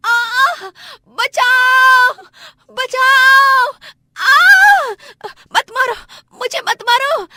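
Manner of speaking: shrieked, wailing cries for help; screaming in pain. Acted. Beaten wife begs for help, screaming in pain. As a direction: scream and sob loudly. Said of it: "दर्द से जोरों जोरों से चीखती चिल्लाती और बिलखती".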